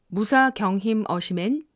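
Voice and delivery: Jeju accent with clipped endings; Jeju dialect. Shown as a demonstration. Narrator demonstrating the hard-to-follow Jeju dialect, clipped Jeju accent. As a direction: cut the ends short, Jeju style. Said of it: "말끝을 짧게 자른 것 같은".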